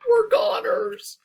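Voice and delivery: very high, loud, slow wail; terrified wail. Acted. Goose hiding in the bush, sure the monster has them. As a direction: wail it in despair.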